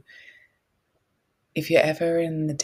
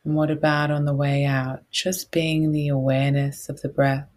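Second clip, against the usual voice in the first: guided meditation; 4 dB louder than usual. Real, not acted.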